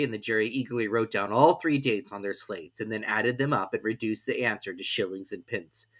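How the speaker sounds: ordinary delivery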